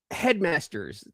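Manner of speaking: normal talking voice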